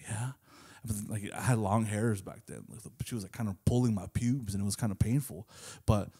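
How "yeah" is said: soft whispered reply. Retold or imitated. Answering the girl in class, whispered. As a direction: whisper back so nobody hears. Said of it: "And I was just like".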